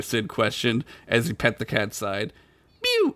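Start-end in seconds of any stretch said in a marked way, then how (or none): 2.8-3.1 high squeaky kitten mew. Acted. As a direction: mew like a tiny cat. Cat voice in story.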